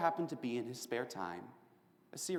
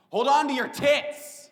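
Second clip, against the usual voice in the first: loud punchline; 13 semitones higher, 10 dB louder than usual. Acted. Punchline to the audience.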